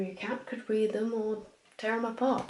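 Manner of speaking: usual speaking voice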